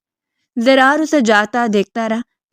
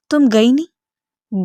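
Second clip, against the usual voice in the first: surprised annoyance; slower than usual. Acted. Angry man finds her still there.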